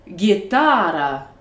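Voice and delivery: "guitara" slowly, guiTAAra; stress on middle syllable. Shown as a demonstration. Teacher showing stress on a real word, "guitara" stressed in the middle. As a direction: stretch and hit the TA of "guitara". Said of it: "voici comment ça sonne avec de vrais mots".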